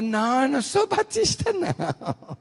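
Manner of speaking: high, squealed protest; hammed-up defensive denial, pitch leaping up; backing-off denial. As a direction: imitate her hastily disowning the label. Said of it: "Aí ela recuou".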